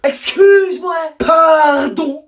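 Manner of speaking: high, shouted indignant excuse-me; indignant protest. Acted. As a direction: protest like the class has been wronged. Outrage at being split up. (cri indigné et aigu)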